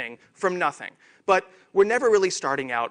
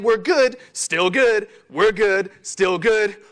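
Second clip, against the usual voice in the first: complacent team; 3 semitones higher, 6 dB louder, slower than usual. Pretend. Team coasting on an old success, sing-song and complacent. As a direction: keep reassuring yourselves it's fine.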